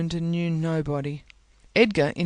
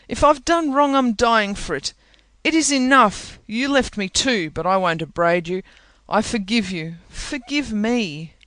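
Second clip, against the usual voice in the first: tearful reproach; 5 semitones higher, 5 dB louder than usual. A narrator's reading. Dying Catherine reproaching Heathcliff.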